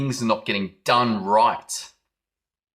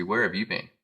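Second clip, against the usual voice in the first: that tone; 2 semitones lower, 5 dB quieter than usual. Retold or imitated. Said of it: "In that tone".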